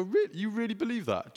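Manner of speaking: high, incredulous "really?"; mock disbelief. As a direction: play it as a teasing challenge. Preacher voicing his own retort.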